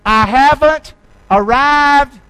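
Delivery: haven't hit hard and drawn out; slow emphatic declaration. Shown as a demonstration. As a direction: proclaim it. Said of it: "Can you say that with me?".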